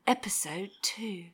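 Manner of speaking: whispered narrator tease; hushed nature documentary. Shown as a demonstration. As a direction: breathe it like a trailer. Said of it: "like that".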